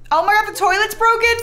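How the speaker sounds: high, loud outcry at the news; sudden loud dismay; shocked shout. Real, not acted. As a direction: yelp it out in alarm. Sudden game mishap.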